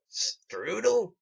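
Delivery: high, mocking question; sneering Fritz. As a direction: taunt the name back at him.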